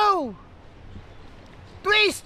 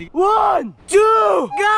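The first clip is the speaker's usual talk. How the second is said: shouted countdown; 2 semitones higher, 6 dB louder, much slower than usual. Real, not acted. Counting down before jumping.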